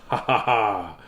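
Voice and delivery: usual speaking voice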